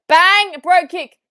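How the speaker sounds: loud, high finisher call; real fan hype; excited shout. Real, not acted. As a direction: shout the move as it lands. Calling the finisher.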